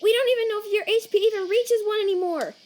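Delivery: high, loud, panicked telling-off; frantic scolding. Acted. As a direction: scold them at a high pitch out of sheer worry. Alarmed scolding of Sans.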